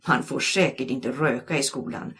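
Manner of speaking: ordinary delivery, nothing marked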